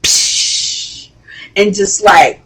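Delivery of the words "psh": stool smash noise. Retold or imitated. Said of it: "Jock takes a freaking stool".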